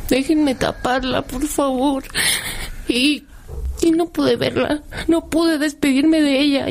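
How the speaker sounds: llorando de verdad, la voz se quiebra hacia arriba; voz quebrada. Real, not acted. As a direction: habla entre lágrimas, aguantando el llanto, más lento. Madre recuerda no poder despedirse. (madre que recuerda no haber podido despedirse de su hija, con la voz rota por el llanto)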